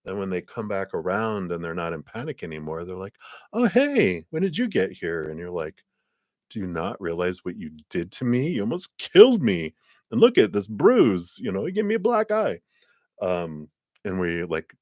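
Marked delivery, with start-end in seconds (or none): 3.5-5.2 high, breezy, oblivious hello; lightly voiced clueless swimmer; airy clueless greeting. Rescued person now calm. 6.5-12.6 indignant complaint. Retold or imitated. Rescuer complaining to swimmer.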